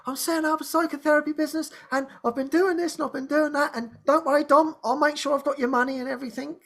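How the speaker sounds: fast, high and eager, words tumbling out; excited rush. Retold or imitated. Telling Dom about his new business, fast and excited. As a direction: gush it out like a thrilled beginner. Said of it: "and I'm all excited".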